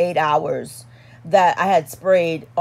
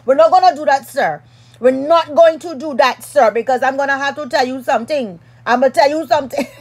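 put-on excited, higher-pitched Trinidadian accent; playful Trinidadian telling-off, getting louder; Trini accent; 4 semitones higher, 4 dB louder, faster than usual. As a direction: slip into a heated Trini accent. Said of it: "switching into another accent. I think that was a Trini accent".

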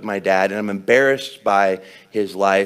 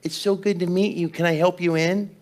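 put-on high-pitched little girl greeting; Hattie; 9 semitones higher than usual. Daughter welcoming Grandpa at the door, high child's voice. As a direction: lift the voice high like an eager small child. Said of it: "she says".

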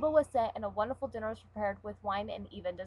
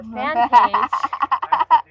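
her fan page stretched very slow and much louder; drawn-out emphasis; 17 dB louder than usual. Acted. Correcting herself to co-host, drags out "her fan page" loudly.

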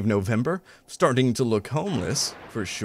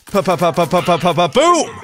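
mouth gunfire; 5 semitones higher, 6 dB louder than usual. Real, not acted.

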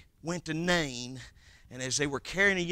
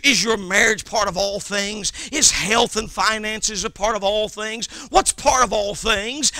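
ordinary delivery

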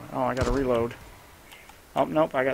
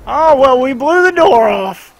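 delighted yell, much higher and louder; excited shout; 12 semitones higher, 18 dB louder, slower than usual. Real, not acted. Gamer to teammate as the door blows, excited shout.

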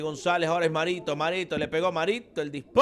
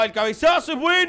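excited; 8 semitones higher, 6 dB louder, faster than usual. Real, not acted. Header commentary.

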